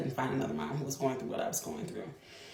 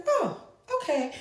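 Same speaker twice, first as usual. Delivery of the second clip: put-on very high little boy's "oh, okay"; son's voice; 6 dB louder than usual. Mother imitating her son accepting the news, very high childlike voice. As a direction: squeak it up like a small boy taking it in.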